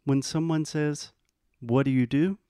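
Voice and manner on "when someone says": usual speaking voice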